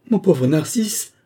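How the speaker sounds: high, loud, slow wail on Mon pauvre Narcisse, rising; a stunned moan of loss, drawn out. A narrator's reading. Flavie wailing over her crushed flower, stunned, high and slow. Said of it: "gémit Flavie stupéfaite".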